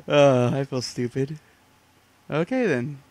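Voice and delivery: loud laugh into sheepish words; laughing at herself, embarrassed. Real, not acted. Finds the way up, laughs.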